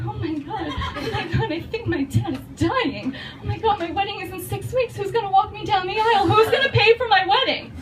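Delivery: high-pitched, hammed-up panic; mock panic. Bride fearing her dad is dying, mock high panic. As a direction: spiral upward into comic desperation.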